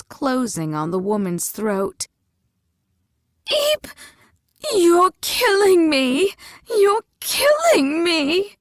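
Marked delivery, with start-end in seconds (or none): 3.5-3.9 very high squealing eep; piercing little shriek. A narrator's reading. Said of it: "the woman shrieked". 4.7-6.3 higher, louder panicked wail, killing rising; frantic shout, killing stressed; panicked cry. A narrator's reading. Old woman being choked. 6.7-8.6 slower, louder sobbing shout, killing rising; desperate crying yell. A narrator's reading. Old woman being choked.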